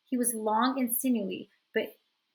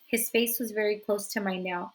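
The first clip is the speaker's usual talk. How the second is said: ordinary delivery, nothing marked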